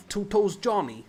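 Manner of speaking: put-on New York accent. Host imitating a New York accent from a podcast episode. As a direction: quote the gangster nickname like a Brooklyn wiseguy. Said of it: "That's the accent you're trying to do".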